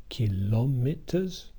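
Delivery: "kilometers" slowly, syllable by syllable; four separated syllables. Shown as a demonstration. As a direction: draw out "ki-lo-me-ters" as four syllables. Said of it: "with the four syllable word".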